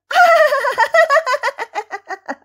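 very high bleating cackle; long, rapid ticklish giggle; goat's laugh. A narrator's reading. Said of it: "laughed the goat".

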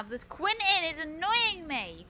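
loud, worked-up gamer complaint; angry. Real, not acted. Gamer venting about Quentin annoying them, angry. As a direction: vent loudly, pitch climbing with annoyance. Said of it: "I'm so angry right now".

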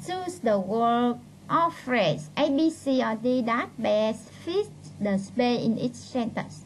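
slow, deliberate English read-out; reading instructions aloud. Real, not acted. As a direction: read the task heading out word by word. Reads exam instruction aloud.

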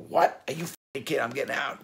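high-pitched, elated surprise; giddy, incredulous celebration; retold excited disbelief. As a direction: act out hearing great news, voice jumping up. Retelling news of getting out.

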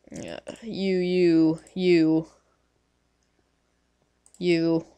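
usual speaking voice

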